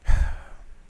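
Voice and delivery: loud, exasperated sigh; fed-up exhale before speaking; heavy sigh. Real, not acted. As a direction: blow out a big annoyed sigh. Annoyed at himself, ranting.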